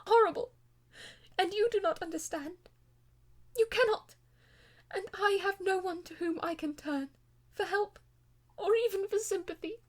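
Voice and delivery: high, loud, despairing cry; anguished wail. Acted. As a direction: plead as though all hope is gone. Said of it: "with a sudden wail of anguish".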